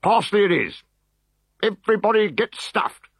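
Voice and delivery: high, commanding officer; officer's order. Acted. Decurion ordering his legionaries, high and commanding. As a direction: settle it and bark the order.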